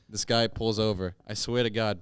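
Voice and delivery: ordinary talk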